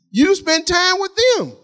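high, slow, drawn-out TIME; emphatic preaching. Real, not acted. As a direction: stretch "time" high to drive it home. Drives home the parents' bond.

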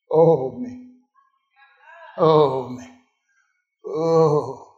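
low, drawn-out mild moan; lightly mock a sorry sufferer; understated woe-is-me groaning; self-pitying moan.

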